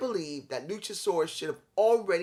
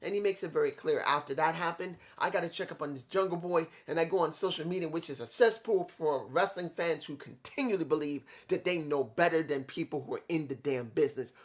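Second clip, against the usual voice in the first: usual speaking voice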